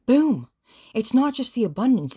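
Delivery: sudden loud, high burst of delight; excited boom. Real, not acted. Tarot reader thrilled by the card to viewer, loud and high. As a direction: shout it like a reveal.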